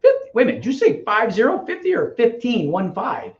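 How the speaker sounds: high-pitched disbelieving double-check; incredulous. Retold or imitated. Novice double-checks the coach's number, incredulous.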